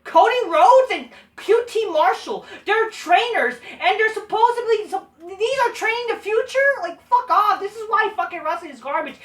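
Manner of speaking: high-pitched angry disbelief; exasperated rant. Real, not acted. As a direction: vent in disbelief. Ranting about trainers.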